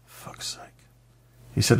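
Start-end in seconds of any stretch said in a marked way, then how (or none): 0.1-0.6 whispered curse; barely audible, whispered frustration; exasperated aside. Retold or imitated. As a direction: swear under your breath in exasperation. Exasperated reaction to brief's answer.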